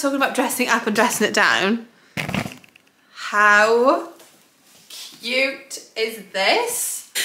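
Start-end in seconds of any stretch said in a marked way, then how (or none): 3.1-7.1 very slow, stretched-out admiration; drawn-out gushing. Real, not acted. Vlogger showing off her new combo, drawn-out gushing. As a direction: linger on each word to show it off.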